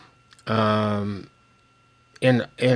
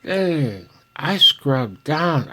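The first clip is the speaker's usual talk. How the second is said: slow, whistly s with tobacco in mouth; whistling through tobacco; 7 semitones higher, 4 dB louder, much slower than usual. Real, not acted. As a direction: talk around a wad of chewing tobacco. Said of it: "I'm whistling again 'cause I have tobacco in my mouth".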